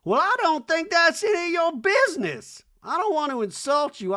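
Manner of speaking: mock [name], sharp and high; hammed-up high [name] voice, slowing on the end; [name] impression. Imitating [name]'s put-down to Garcelle.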